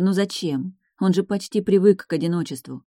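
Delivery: usual speaking voice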